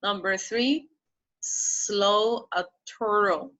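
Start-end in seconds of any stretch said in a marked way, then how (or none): 1.4-3.5 slow model reading. Shown as a demonstration. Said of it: "slow model reading".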